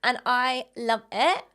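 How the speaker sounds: drawn-out slow influencer uptalk. Shown as a demonstration. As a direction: end each phrase as if asking a question. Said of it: "Intonation is like, everything's a question".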